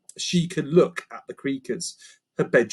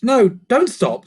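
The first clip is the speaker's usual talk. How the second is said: slow, louder, higher; insistent; 10 semitones higher, 7 dB louder, slower than usual. Acted. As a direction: stop them mid-move. Lucy insists.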